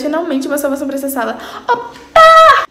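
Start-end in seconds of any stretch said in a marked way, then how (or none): 1.7-2.7 excited yelp with a laugh. Real, not acted.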